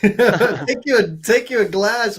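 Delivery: giggly toast; laughing. Real, not acted. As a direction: laugh while offering a toast. Host laughing, toasting the speaker.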